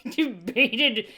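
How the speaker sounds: laughing through the word debated. Real, not acted. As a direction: say debated while laughing at the slip. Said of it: "laughs debated".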